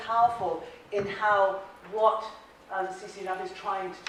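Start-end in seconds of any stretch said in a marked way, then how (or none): none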